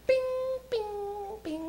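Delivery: short ringing bings; imitated door chime. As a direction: chime like closing subway doors.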